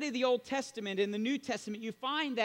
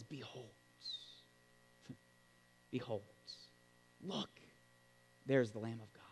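quiet behold; 10 semitones lower, 11 dB quieter than usual. Retold or imitated.

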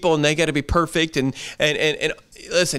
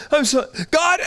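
a high, exasperated cry at God; mock angry complaint; 14 semitones higher, 3 dB louder than usual. Preacher mimics a believer griping at God, exasperated. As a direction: squeal it up like a frustrated believer. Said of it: "to be angry and tell him".

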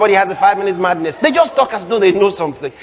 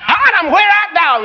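God calling out; 8 semitones higher than usual. Acted. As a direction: call out for someone hiding. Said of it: "God is pretending he doesn't know".

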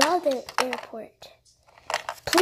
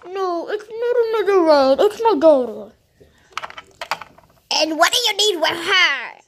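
heated argument; 3 semitones higher, 6 dB louder than usual. Pretend. Arguing with the taxi driver.

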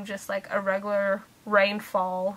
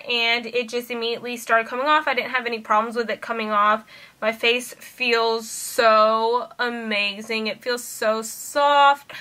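ordinary talk, nothing marked

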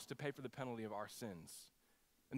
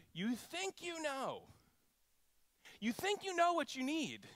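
emphatic challenge; 11 semitones higher than usual. Real, not acted. Preacher confronting listeners.

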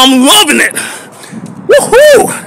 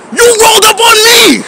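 real angry shouting; 8 semitones higher than usual. Real, not acted. Venting to viewers about the guard, furious shout. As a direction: yell it, voice cracking with anger.

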